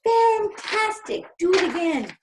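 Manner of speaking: put-on high, loud, stretched shout for kids to copy; a loud, high demo shout. Shown as a demonstration. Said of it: "Can you shout".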